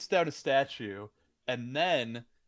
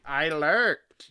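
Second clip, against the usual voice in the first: cartoon Skeletor voice; 4 semitones higher, 10 dB louder than usual. Mimicking Skeletor line.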